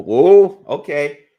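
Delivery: startled high whoa; surprised whoa. Real, not acted. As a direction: caught off guard by the action. Sudden clinch in the fight.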